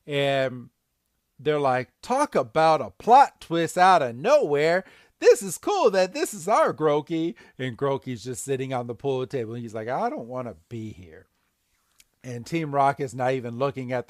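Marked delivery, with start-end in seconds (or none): 2.0-7.4 pitched-up, excited cartoon delivery; mock amazement. Podcaster voicing Team Rocket at a plot twist, excited. 9.9-11.3 soft, reluctant aside; glum mumble. Retold or imitated. Podcaster voicing Groki stuck at the lair, quiet reluctance.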